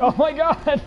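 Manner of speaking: high-pitched starstruck gasp of words; retold astonishment. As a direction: reenact being caught off guard, voice jumping up. Retelling his startled reaction.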